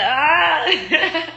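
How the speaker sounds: high drawn-out "aah" breaking into laughter; mock cry. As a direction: mimic a wail, half laughing. Imitates the doomed relationship clash.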